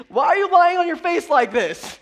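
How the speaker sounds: high, loud incredulous demand; acted divine reprimand; shouted rebuke. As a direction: scold at full volume. Acts God rebuking Joshua.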